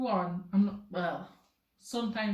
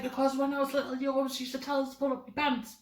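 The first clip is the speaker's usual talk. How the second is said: higher-pitched retold protest; protesting grandchild; 5 semitones higher, faster than usual. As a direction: talk back to grandma, high and quick. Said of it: "I'd be like".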